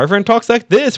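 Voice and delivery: put-on old Hollywood mid-Atlantic accent, pitched high. Shown as a demonstration. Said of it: "a mid-Atlantic accent that existed only in this time".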